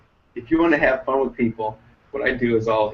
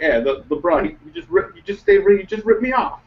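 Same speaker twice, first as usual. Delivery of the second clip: imitated Hawaiian accent, rising high; 8 semitones higher, 5 dB louder than usual. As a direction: complain as a ripped-off kid with a Hawaiian accent. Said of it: "a Hawaiian accent".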